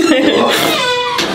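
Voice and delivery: high, tearful laughing sob; laugh-cry. Real, not acted. Patient laugh-crying after thanking the chiropractor. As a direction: let a teary laugh break out.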